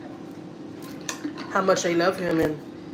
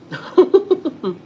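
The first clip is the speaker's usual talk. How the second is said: high delighted laugh; 10 semitones higher, 3 dB louder than usual. Real, not acted. Laughing at nephew on call.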